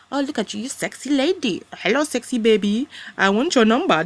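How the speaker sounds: exaggerated mock flirting, swooping pitch; sleazy chat-up man. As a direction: mimic a shopkeeper hitting on customers. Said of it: "sexual harassment of".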